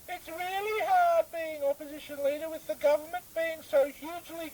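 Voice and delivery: very high, squeaky, whiny complaining voice; a squeaky, whiny politician who keeps forgetting his own name; squeaky opposition leader. Acted. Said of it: "some fellow talking in a squeaky voice".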